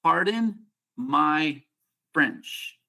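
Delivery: slow, spaced out, word by word; deliberate, with a pause before the last word; word-by-word model. Shown as a demonstration. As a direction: say each word separately for a learner to copy. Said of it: "three words I want you to say".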